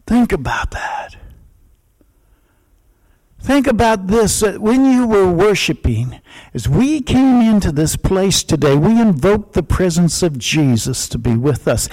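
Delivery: ordinary talk, nothing marked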